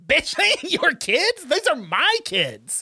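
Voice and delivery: high, indignant mock comeback; hammed-up angry retort. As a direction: fire back as the outraged rival mother. Acted quarrel over the children.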